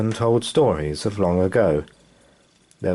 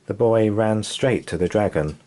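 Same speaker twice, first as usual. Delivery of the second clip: ordinary delivery, nothing marked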